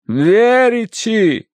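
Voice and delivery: high, long-drawn name; shaming reproach. A narrator's reading. Aunt shaming her niece Верити, reproachful. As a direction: scold by stretching out the name in a high voice. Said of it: "пристыдила её миссис Меринг тоном".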